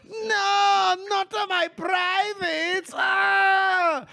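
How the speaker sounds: high yelp when punched; shouting in pain. Pretend. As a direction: cry out as if hit. Villain gets punched.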